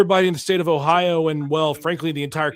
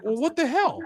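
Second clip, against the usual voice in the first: exasperated; 10 semitones higher than usual. Retold or imitated. Exasperated quote of viewers.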